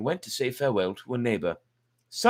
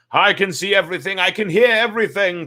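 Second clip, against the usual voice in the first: put-on much higher, louder old man's cry; 10 semitones higher, 8 dB louder than usual. A narrator's reading. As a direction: shout it out high from inside the bag. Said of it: "cried the old man".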